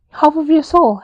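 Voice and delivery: high, loud and gushing; loud swoon at a romantic line. Real, not acted. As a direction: gush over the quote. Swooning reaction.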